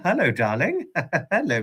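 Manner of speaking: ordinary delivery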